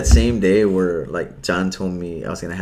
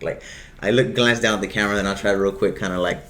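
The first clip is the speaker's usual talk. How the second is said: normal talking voice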